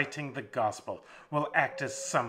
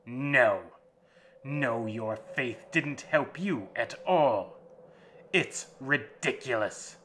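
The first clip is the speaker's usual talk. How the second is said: vampire snarls at the captive's faith, loud; snarling vampire; 2 semitones higher, 9 dB louder than usual. Acted. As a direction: tear his faith apart. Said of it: "the master snarled".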